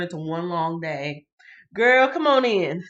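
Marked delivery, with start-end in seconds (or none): 1.7-2.8 excited welcome. Real, not acted. Welcoming a friend.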